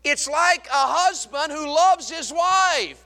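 loud, high, slow and stretched; slow, ringing preacher's call; preaching to children. Retold or imitated. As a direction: belt it out slowly for the kids. Preaching loudly to the juniors.

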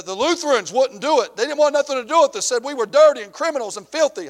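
high, loud, worked-up complaint; retold indignant rant. Gang leader complains to preacher about Lutherans, loud indignant. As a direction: let the leader's grievance boil over.

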